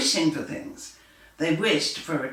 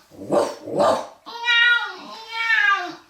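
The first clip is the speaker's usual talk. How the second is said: dog and cat; 5 dB louder, much slower than usual. A narrator's reading. Reading to children, dog chasing a cat. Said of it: "the dog wished for a cat".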